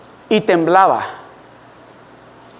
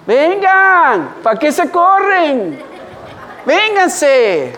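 mocking taunt; 6 semitones higher, much slower than usual. Boy jeering at the fleeing bullies, high and laughing. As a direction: jeer at them as they flee. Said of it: "Ajá".

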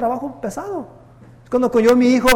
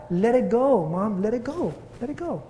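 gentle plea; 7 dB quieter, slower than usual. Retold or imitated.

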